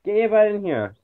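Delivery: loud, high call across the house; yelling at the dog. Real, not acted. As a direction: shout the dog's name to come in. Calling the dog in.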